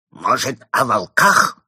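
loud, menacing hoarse question; hoarse villain. A narrator's reading. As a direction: press the threat in a villain's croak. Hoarse villain keeps pressing the mole.

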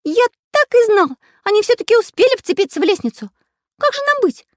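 very high, loud cartoon-like voice; panicky character voice. A narrator's reading. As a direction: fret loudly in a squeaky-high pitch. Character panics about the robbers. (высокий панический голос)

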